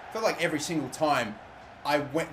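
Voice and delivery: usual speaking voice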